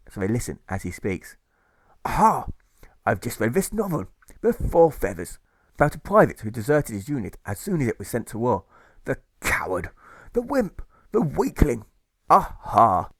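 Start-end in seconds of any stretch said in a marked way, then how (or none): 2.0-13.1 loud, pompous military bluster; blustering Admiral. Acted. Admiral preaching to the ladies, pompous bluster. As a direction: bark it like a puffed-up admiral. Admiral ranting about deserter.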